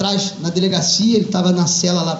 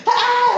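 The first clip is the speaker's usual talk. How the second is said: pained scream; 3 dB louder than usual. Acted. Son bites mother's nose.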